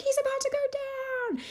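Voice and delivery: put-on high, thrilled child shout; excited kids. Kids on the bus cheer at a skateboarder, high and thrilled. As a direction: squeal it like kids glued to the window.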